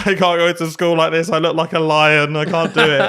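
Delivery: loud, high retold panic; hammed-up morning meltdown; mock anxiety. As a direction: wail it in comic panic. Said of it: "huge anxiety".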